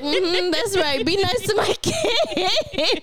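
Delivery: mock sassy reply. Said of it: "I was like".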